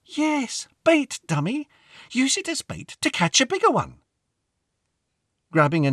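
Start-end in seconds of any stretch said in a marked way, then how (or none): 0.0-4.0 put-on impatient little girl. A narrator's reading. Lucy explains impatiently to boy.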